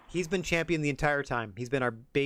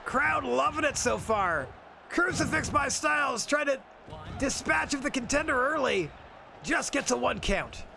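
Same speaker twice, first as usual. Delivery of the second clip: pitched-up, lively commentary; excited play-by-play; 10 semitones higher than usual. Acted. Commentator calling the wrestling match, excited play-by-play. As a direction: call the action as it happens.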